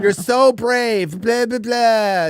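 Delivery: high, very slow sarcastic gushing; mocking fawning praise. Ridiculing congressmen praising witnesses, high sarcastic drawl. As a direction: drag it out in a squeaky mock-admiring voice. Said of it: "Enough with the preambles and thanking everybody".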